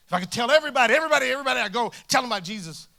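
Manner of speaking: fervent, rising insistence; eager zeal. Retold or imitated. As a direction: build with breathless eagerness to tell everyone. Building zeal in retold belief.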